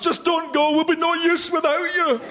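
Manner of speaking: mock English pleading, climbing high. English begging Scotland to stay, mock pleading. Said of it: "And you say".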